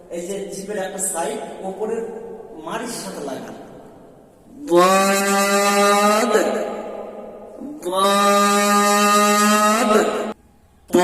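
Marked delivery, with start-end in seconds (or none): 4.7-9.9 দাদ held long and sung; drawn-out dad. Shown as a demonstration. Teacher models a long sung দাদ. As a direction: stretch দাদ like a chant. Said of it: "জদের উচ্চারণটা বাইর হবে".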